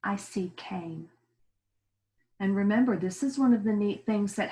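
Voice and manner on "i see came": whispered answer. Shown as a demonstration. Teacher whispering the sight word to pupils. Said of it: "whispered answer".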